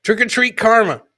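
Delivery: usual speaking voice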